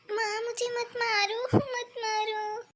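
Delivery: high-pitched crying plea, slowing at the end; tearful pleading. Acted. Daughter begs not to be beaten.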